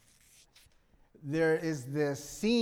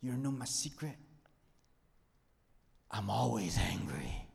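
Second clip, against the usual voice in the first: hushed Bruce Banner line. Retold or imitated. Retelling the Avengers scene, whispered confession. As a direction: let the secret slip out in a hush. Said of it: "Something like this".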